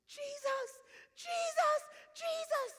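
very high, piercing mock scream; imitated scream. Woman screams on hearing of socks, high-pitched. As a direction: scream the name three times, imitating an overjoyed cry. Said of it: "She starts screaming".

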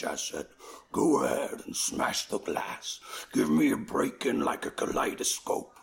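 [name] voice. Retold or imitated. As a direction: drop way down and growl the poem slowly. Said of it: "In [name]' voice".

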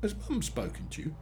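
careful, uncertain asking; slow and hesitant. A narrator's reading. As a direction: ask it hesitantly, feeling your way. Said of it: "She spoke slowly, hesitantly".

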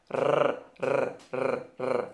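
repeated trilled "R" drill; rolled R drill. Shown as a demonstration. Teacher drilling the trilled Spanish "R" for students. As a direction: trill each "R" hard behind the teeth. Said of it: "Agora o R é Rá".

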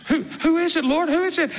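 high, agitated questions tumbling out; urgent questioning. Acted. Disciples asking Jesus who will betray him, urgent questioning. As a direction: ask urgently, pitched well up.

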